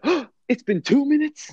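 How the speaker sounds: high, gasping mock surprise. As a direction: gasp out in fake shock. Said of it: "I was gonna be like".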